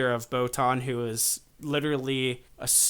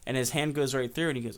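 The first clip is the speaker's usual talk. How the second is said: normal talking voice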